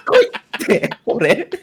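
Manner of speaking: talking through laughter; laughing hard. Real, not acted. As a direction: barely get words out laughing. Cracking up after robot voice. (大笑いしながら)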